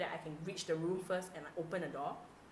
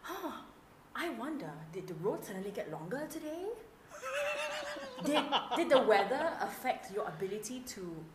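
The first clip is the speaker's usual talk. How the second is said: high mock-innocent wondering; passive-aggressive; 8 semitones higher, 3 dB louder than usual. Host shades a late client, passive-aggressive. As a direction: muse aloud with pointed fake curiosity. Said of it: "I would prefer the passive-aggressive way".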